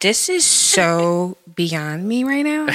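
slow, drawn-out put-on disbelief; Rebecca voice. Shown as a demonstration. Showing co-hosts her work voice, put-on Rebecca voice. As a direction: stretch it out like an overwhelmed office drama queen. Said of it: "I throw on my Rebecca voice".

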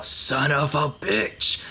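drawn-out, high cursing yell. Acted. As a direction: yell it slowly, stretching each word. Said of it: "I yell".

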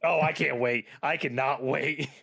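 excited, drawing out the second take; real excitement. Real, not acted. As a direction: gush with real anticipation. Eager reaction to book two.

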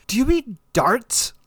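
slow English accent mocking the Boston darts; posh English accent. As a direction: ask it like a proper English gent. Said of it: "the most English accent in the world".

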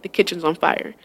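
low, soft, matter-of-fact retelling; calm, casual report. Retold or imitated. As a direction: report it quietly without any alarm. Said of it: "we were talking regular".

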